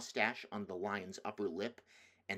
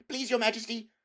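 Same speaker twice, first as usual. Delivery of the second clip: put-on high, anxious boy's voice; pleading boy; 8 dB louder than usual. Edmund pleading with the witch, anxious. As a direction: beg her not to be angry.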